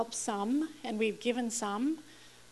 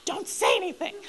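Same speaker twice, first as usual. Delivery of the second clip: higher and louder, laughing through the plea; half-laughing mock prayer; 8 semitones higher, 5 dB louder than usual. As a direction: beg with a laugh breaking in. Praying God stays silent.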